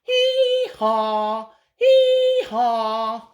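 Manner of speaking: loud hee-haw bray; braying donkey. Acted. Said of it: "The donkey just began to bray".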